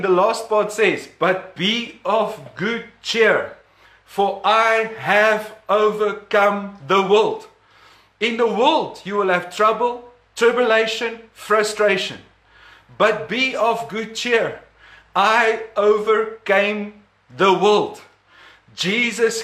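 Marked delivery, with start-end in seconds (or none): 1.2-7.5 slow emphatic reading. Shown as a demonstration. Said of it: "just to confirm what I'm saying". 13.0-18.0 slow emphatic reading. Shown as a demonstration. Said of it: "just to confirm what I'm saying".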